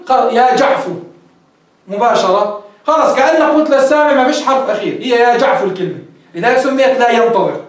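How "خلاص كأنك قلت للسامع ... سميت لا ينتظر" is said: ordinary talk, nothing marked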